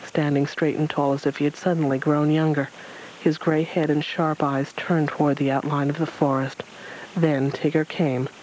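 plain reading voice

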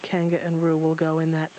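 usual speaking voice

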